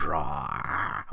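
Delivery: usual speaking voice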